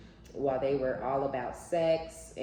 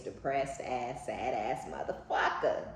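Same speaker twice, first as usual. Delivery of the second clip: ordinary delivery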